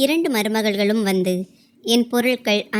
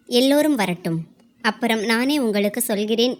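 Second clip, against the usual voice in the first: ordinary delivery, nothing marked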